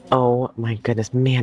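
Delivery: usual speaking voice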